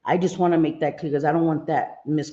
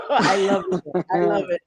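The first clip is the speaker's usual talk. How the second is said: ordinary talk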